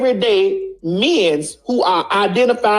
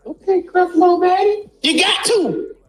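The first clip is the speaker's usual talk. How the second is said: much higher, laughing exclamation; laughing mock; 6 semitones higher than usual. Real, not acted. Laughing at viewers who believe lying men. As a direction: crack up mid-rant. Mocking preacher name, laughing.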